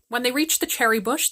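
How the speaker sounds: ordinary delivery, nothing marked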